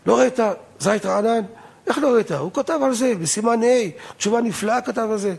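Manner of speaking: high-pitched, indignant rabbi's rebuke. Retold or imitated. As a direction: imitate a rabbi raising his voice, pitched well up. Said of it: "הרב ככה הרים את קולו". (הרמת קול של רב, בחיקוי גבוה)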